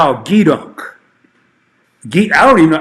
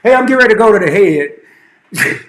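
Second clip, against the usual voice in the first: navy slang habit; 4 semitones higher, 3 dB louder than usual. Retold or imitated. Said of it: "still talking like that".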